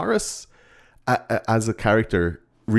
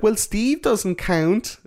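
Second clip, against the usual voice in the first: high, slow mock girl voice; girl's excuse; 13 semitones higher, much slower than usual. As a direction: stretch it out high, imitating her. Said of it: "And she's like".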